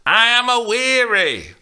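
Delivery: put-on high, loud old nurse's snap; the nurse snapping at Juliet; snapped. A narrator's reading. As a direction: snap it as a worn-out old woman. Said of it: "Lynn snapped".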